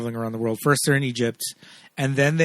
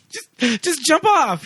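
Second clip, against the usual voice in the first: frantic pleading; 13 semitones higher, much slower than usual. Acted. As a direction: beg her urgently, pitched way up. Acts Patrick frantically coaching Mame.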